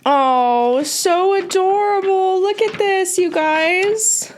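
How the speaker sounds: high-pitched cooing over something adorable; thrilled, sing-out adoring squeal; gushing delight. Real, not acted. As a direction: coo and gush, voice lifted high. Gushing over fan art.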